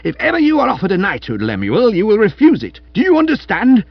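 high, loud commanding tirade; ringing costermonger bellow. A narrator's reading. Wife forbidding husband a knighthood, ringing bellow. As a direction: lay down the law like a street hawker. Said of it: "rang through the room like that of a costermonger".

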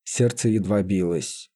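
ordinary delivery, nothing marked

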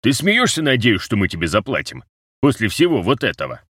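outraged, incredulous questioning; indignant disbelief. A narrator's reading. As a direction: scoff at an outrageous demand. Rose scoffs at demand for pay.

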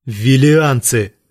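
slow, loud blurted answer. A narrator's reading. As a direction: blurt it out hard. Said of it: "выпалил он".

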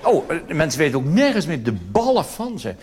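ordinary talk, nothing marked

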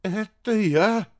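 high, drawn-out unsure question. A narrator's reading. Unfamiliar radio operator answering the admiral, very unsure. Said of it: "не очень уверенный".

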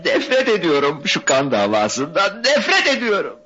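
high, wailing cry of hatred; tormented, impassioned high voice; anguished outburst. Acted. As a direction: cry it out in torment, pitch climbing. Anguished outburst against the feud.

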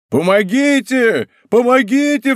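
high, rising panicked shout for help; frightened cry for help. A narrator's reading. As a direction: scream for help in terror. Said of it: "вскричал он".

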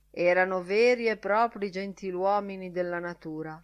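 usual speaking voice

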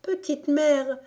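put-on high, loud child's cry for mother. A narrator's reading. Beaten boy crying out for his mother, high and loud. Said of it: "Yanko s'écria".

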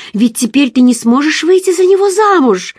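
frivolous happy cry; light, cheerful exclamation. A narrator's reading. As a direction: toss it out brightly, almost pleased. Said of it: "воскликнула она с легкомысленным и весёлым видом".

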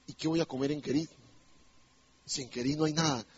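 soft, low acted worry; doubtful muttering. Preacher voicing Elías wondering what he will eat, doubtful muttering.

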